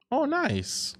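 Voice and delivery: high, soft "oh, nice"; pleased coo. Real, not acted. Pleased reaction to the job being done, high soft coo.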